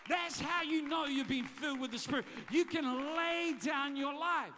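loud and slow, hammering each word; fiery pulpit climax, loud and slow; full-force preaching. Real, not acted. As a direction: preach it like a revival.

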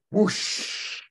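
airy rushing-water noise; long hushing whoosh; imitated water whoosh. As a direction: make a gush of water with breath.

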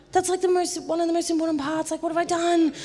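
high, rushed panicked inner voice; imitating her own alarm. Retelling how she panicked after forgetting repentance, high mock panic. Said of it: "don't panic".

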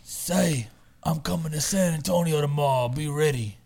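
lightly voiced Mikey, like a longtime smoker; a hint of Mikey's smoker voice; Mikey impression. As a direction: do your buddy's smoky-voiced way of talking, understated. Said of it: "That's how he talks".

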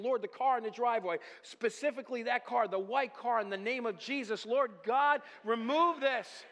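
loud, high, fervent prayer; crying out to God. Retold or imitated. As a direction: cry out the plea at full force. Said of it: "crying out to God every day".

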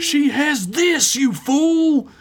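high, drawn-out outraged character voice; indignant scolding. Acted. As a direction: berate a fool in a high, slow voice. Villain berates an underling.

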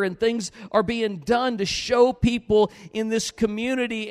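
preaching that builds to a high, loud cry; rally the congregation, rising to a ringing shout; impassioned preaching. Real, not acted. Urging a church on toward God's work, building fervent preaching.

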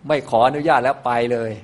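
mildly exasperated, acted out; a light mock groan of giving up; fed up. Bodhisattva quitting after being stalled by his wife, mildly fed up. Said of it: "สัตว์เซ็งเลย".

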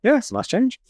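normal talking voice

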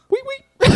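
very high squeaky two-note whistle; imitated toothless whistle. Imitating patient's whistle at women, high squeal. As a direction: squeak out a mock wolf whistle. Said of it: "I used to whistle at 'em".

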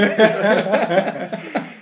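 rising laugh; long rolling laugh. Real, not acted. Laughing at Christmas being ruined, rising laugh.

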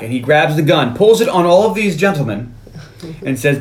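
usual speaking voice

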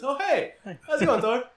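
high, casual mock greeting; breezy Jane. Jane greets Thor breezily in a high voice.

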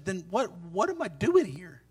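low, soft, halting question; questioning himself. Acted. Elijah begins to ask himself the question, low and halting. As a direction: ask it under your voice, as if to yourself. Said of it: "begins to ask himself the question".

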